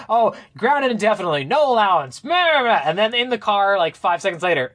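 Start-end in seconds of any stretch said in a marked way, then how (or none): 0.1-2.8 high, whiny blah-blah mockery of a stern dad; dismissive mock dad. Host mocking the dad's flimsy grounding speech, high and whiny. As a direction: brush off the punishment like it's nothing, mocking. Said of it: "The dad's like".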